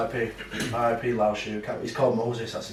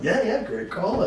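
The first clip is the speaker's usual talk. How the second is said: laughing imitation; 10 semitones higher than usual. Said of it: "And he's like".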